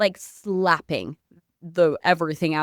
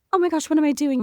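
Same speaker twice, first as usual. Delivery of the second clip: higher, louder alarmed gasp of a question; startled realisation; 8 semitones higher, 4 dB louder, faster than usual. Retold or imitated. Realising she is slapping a stranger, startled self-question. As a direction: catch yourself mid-act.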